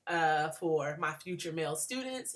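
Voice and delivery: normal talking voice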